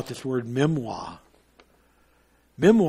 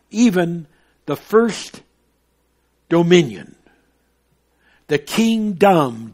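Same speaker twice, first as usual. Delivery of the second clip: scripture reading; 3 semitones higher, 5 dB louder than usual. Acted. Continues the quoted verse.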